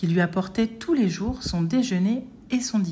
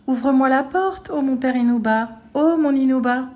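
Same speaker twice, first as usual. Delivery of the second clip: high, clear chanted ogre voice; thin singsong ogre; 6 semitones higher, 4 dB louder than usual. A narrator's reading. Ogre imitates the girl at the door, singsong. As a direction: chant in a falsely fine, girlish voice. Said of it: "chantonna devant sa masure".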